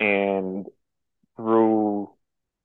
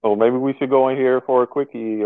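sly comment; 4 semitones higher than usual. Retold or imitated. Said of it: "little sly comments like".

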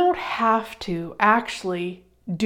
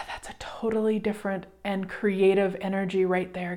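sighed breathy whisper; 3 semitones lower, 7 dB quieter than usual. Real, not acted. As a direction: hush it like a secret. Unvoiced whisper after sigh.

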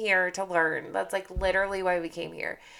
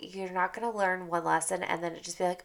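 ordinary delivery

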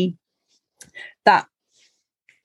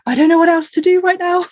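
high, louder helpless outburst; exasperated cry; 9 semitones higher, 3 dB louder than usual. Retold or imitated. A worn-out mother at her wits' end, high and loud. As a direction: throw up your hands, high. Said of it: "You're like".

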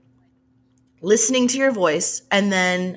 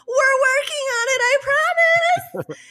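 very high, strained comic squeal; mock-frantic promise; faster than usual. Author promising her waiting editor, in a hammed-up high squeal. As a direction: squeak out a desperate reassurance, hammed up.

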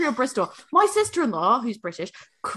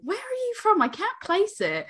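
curious stranger; 9 semitones higher, 3 dB quieter than usual. Retold or imitated. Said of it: "someone was like".

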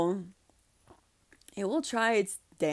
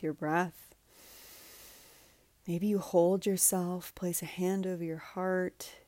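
quiet, soothing coach guiding a breath; guided meditation; 4 dB quieter than usual. Acted. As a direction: lead the listener gently into the body.

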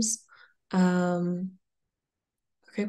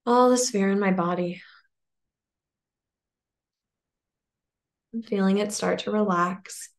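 guided tapping script. Acted. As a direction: lead them gently, line by line.

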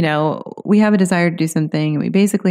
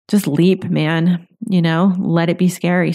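ordinary talk, nothing marked

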